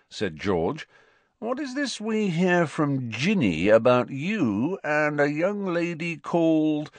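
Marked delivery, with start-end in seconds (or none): 1.4-6.9 high, mock-formal inquiry; teasing. A narrator's reading. George quizzing Ron about a girl, teasing. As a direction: build up the gossip with relish, pitched high.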